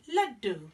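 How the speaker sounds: laddu said slowly, LUD-doo, with a falling end; laddu said clearly. Shown as a demonstration. Storyteller naming the Indian sweet for children, said carefully. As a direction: offer laddu as a new word. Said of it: "the traditional Indian dessert".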